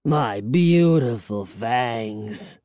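low, slow vampire lament; moaning. A narrator's reading. Vampire grieving his lost fangs, moaning. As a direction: mourn it in a drawn-out moan. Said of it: "I heard him moan behind me".

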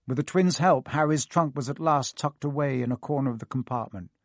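ordinary delivery

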